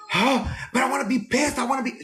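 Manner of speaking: high, indignant protest; indignant whine. Retold or imitated. Flight attendant to calm coworker, high indignant protest. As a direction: protest that you want to stay angry.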